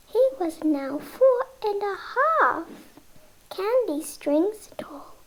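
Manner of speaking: ordinary delivery, nothing marked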